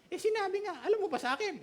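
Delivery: hammed-up high-pitched retort; mock stubborn insistence. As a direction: push back like a stubborn believer. Believer answering back insistently.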